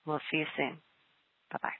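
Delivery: ordinary delivery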